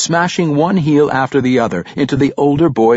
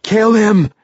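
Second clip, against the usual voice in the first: a high, louder pained shout; gasping, pained order; 11 semitones higher, 5 dB louder than usual. A narrator's reading. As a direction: bark the kill order through pain, short of breath. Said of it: "in a gasping and pained voice".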